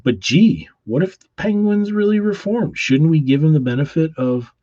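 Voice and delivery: Robin. Acted. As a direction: plead like a hopeful kid sidekick.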